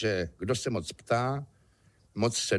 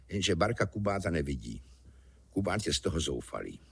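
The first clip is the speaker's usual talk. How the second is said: ordinary delivery, nothing marked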